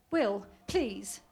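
loud, pleading edge of panic; hint of hysteria. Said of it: "a faint note of hysteria".

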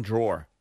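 "Drawer" as "draw-er" with a New York vowel. Shown as a demonstration. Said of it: "You know what's another one?".

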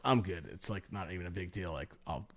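very soft, low self-reassurance; casual self-assurance. Retold or imitated. Driver reassuring himself about a tight squeeze, low and casual. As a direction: brush it off under your breath.

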